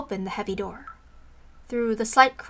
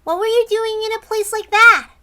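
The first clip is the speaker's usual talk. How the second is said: put-on child's voice; 11 semitones higher, 8 dB louder than usual. Child asks the captive.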